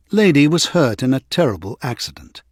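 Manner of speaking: ordinary delivery, nothing marked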